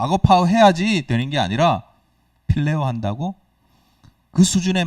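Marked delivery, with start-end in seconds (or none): 0.0-1.0 louder, higher mock scolding of a disciple. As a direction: play the scolding Jesus he is not, hammed up. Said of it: "막 혼내는 게 아니라".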